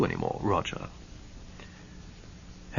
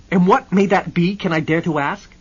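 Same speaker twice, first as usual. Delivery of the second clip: loud, high sarcastic challenge; daring him to explain; sarcastic Hennessy; 9 semitones higher, 12 dB louder than usual. Acted.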